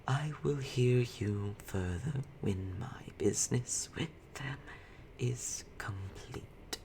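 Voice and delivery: slow, soft whisper. Acted. Webmother ordering the drow aside, whispered. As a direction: command them in a slow hush. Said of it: "she says".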